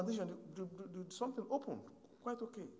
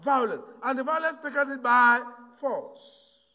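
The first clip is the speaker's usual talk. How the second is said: louder, slow and weighty scripture; full-force preaching; 3 semitones higher, 9 dB louder, slower than usual. Acted. Preacher drives home Matthew to the congregation, full-force preaching. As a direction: thunder the verse at the congregation.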